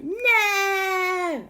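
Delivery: high, far-off panicked cry; crowd cries no. Acted. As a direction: wail it like a scared crowd. Crowd sees dinosaurs smashing things.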